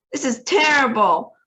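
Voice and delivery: wailed. A narrator's reading. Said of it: "wailed Missus T V".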